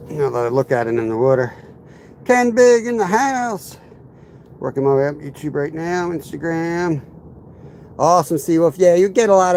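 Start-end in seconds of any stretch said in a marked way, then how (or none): 2.2-3.8 hype announcer call. Real, not acted. Hyping a viewer's arrival.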